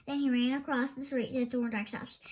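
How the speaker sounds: ordinary delivery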